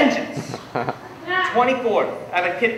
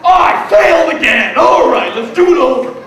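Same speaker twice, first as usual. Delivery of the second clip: mock exasperated shout; 7 dB louder than usual. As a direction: yell it like another failed exam. Acting out waking to test results.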